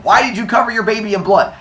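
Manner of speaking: acted furious demand; angry yelling. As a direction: confront them in outrage. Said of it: "start yelling back and forth".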